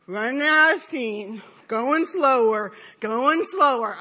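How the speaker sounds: low, slowing, drawn-out words; mock weary, dragging delivery; running out of steam. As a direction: wind down like a tiring swimmer. Acts out losing steam.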